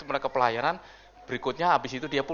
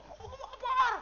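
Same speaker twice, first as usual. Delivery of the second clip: imitating fear; 7 dB quieter, slower than usual. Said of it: "yang mereka".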